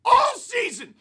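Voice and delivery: angry fan rant; angry yell. Real, not acted. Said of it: "about to do a lot of it".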